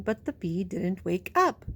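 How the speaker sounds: usual speaking voice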